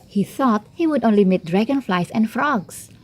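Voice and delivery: usual speaking voice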